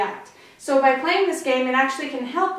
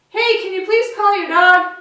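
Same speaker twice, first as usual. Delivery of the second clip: high, loud call across a distance; 7 semitones higher, 7 dB louder than usual. Shown as a demonstration. Showing the cue for dogs to come, loud voice. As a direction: project it high to a far-off owner. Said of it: "in a loud voice".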